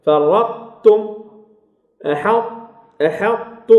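فرطتم and أحط with heavy ṭ held, then released as light t; incomplete merging. Shown as a demonstration. Teacher models فرطتم and أحط, emphatic ṭ partly merged. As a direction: keep the ط of أحط heavy and unbounced into tu. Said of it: "وبقاء صفة الإطباق".

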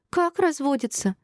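high, drawn-out shocked question; slow high-pitched squeal. A narrator's reading. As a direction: squeak it out in disbelief. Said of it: "запищала Шинар".

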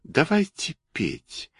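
high, soft, slow cat; toy cat voice. A narrator's reading. Toy cat invites the others to sing, high soft cat voice. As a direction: propose it in a small, high, drawn-out voice. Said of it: "Кошка сказала".

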